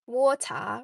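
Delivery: "water" drawn into two slow parts, Chinese accent. Shown as a demonstration. Playfully comparing accents for viewers, Chinese accent. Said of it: "And then in Chinese accent, it'd be".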